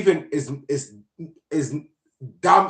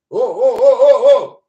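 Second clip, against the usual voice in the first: mock wailing crowd; 10 semitones higher, 11 dB louder than usual. Protesters wailing as president passes.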